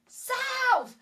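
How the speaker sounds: very high yell of his name; calling out. Retold or imitated. Wife yelling for husband who rode away, high call. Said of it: "and I was like".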